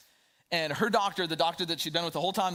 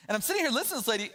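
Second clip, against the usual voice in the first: usual speaking voice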